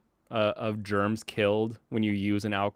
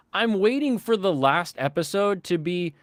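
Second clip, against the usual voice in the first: ordinary talk, nothing marked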